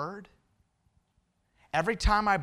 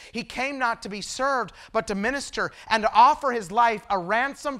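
preaching at full force; 4 semitones higher, 6 dB louder than usual. Acted. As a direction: drive the gospel home, swelling. Rising gospel appeal.

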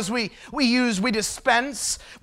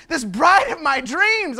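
ordinary delivery

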